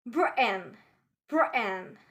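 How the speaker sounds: "Britain" with a catch in place of T; glottal stop. Shown as a demonstration. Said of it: "We'll pronounce it like".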